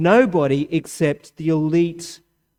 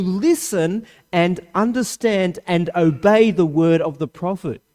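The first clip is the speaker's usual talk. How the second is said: raised, fast preaching voice; 6 semitones higher, 5 dB louder than usual. Acted. Preacher building to the congregation, raised and driving. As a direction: proclaim it to the congregation. Preaching at full force opening.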